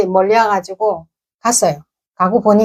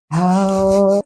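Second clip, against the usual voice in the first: ordinary delivery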